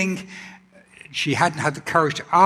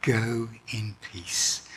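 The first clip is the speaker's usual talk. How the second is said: soft, slow and tender; a healer blessing the woman softly and slowly; gentle words; 5 semitones lower, 6 dB quieter, slower than usual. Acted. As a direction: ease the blessing out very softly, slowing down. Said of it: "Such gentle words to her".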